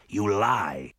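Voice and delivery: shouted, angry elf; angry accusation. Acted. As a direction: hurl the accusation at them. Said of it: "slammed the butt of his staff".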